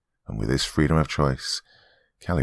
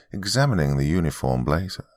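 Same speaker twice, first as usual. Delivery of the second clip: ordinary delivery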